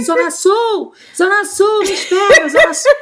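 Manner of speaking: high, loud street call; shouting for the van. Retold or imitated. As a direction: yell the destination to stop the van. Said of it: "eu comecei a gritar". (gritando Zona Sul para a van parar)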